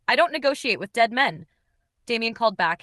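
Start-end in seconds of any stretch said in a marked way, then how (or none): none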